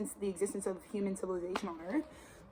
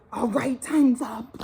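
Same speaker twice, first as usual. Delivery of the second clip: mock bossy announcement; 9 dB louder, much slower than usual. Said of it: "have people being like".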